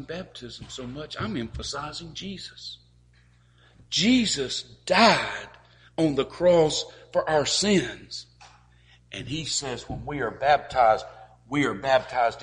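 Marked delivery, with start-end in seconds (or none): 3.9-8.3 slow, loud, every word weighed; slow solemn emphasis. Acted. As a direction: lean hard on each word.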